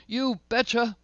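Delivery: high, chirpy "You betcha", full of life; cheery you betcha. Retold or imitated. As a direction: toss it off brightly, life saying yes. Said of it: "Terence said".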